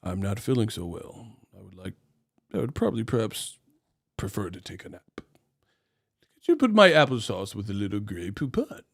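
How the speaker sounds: put-on a fussy aristocrat toddler; posh kid. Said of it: "in a short period of time".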